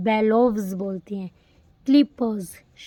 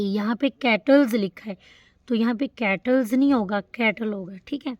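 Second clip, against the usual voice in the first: ordinary delivery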